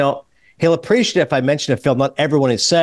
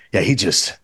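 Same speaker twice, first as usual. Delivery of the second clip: low, slow, drawn-out Pacino murmur; Al Pacino impression; 7 semitones lower, slower than usual. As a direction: savor the word glides, low and unhurried. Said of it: "the way he said it".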